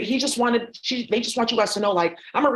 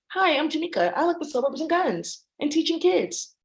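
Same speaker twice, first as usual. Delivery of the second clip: higher mock-sweet intro voice; imitating cousin; 6 semitones higher than usual. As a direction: play the cousin introducing herself. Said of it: "my cousin Jamaica Somerville exposing it".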